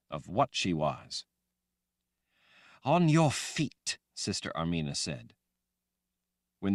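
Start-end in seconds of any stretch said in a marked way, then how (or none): 2.8-4.0 higher, slow order; cold order. A narrator's reading. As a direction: command the prisoner up. Sister Armina orders her.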